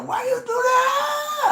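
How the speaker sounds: high shrieking cry; screaming in pain. Retold or imitated. Patient screaming at dentist after the needle, shrieking high.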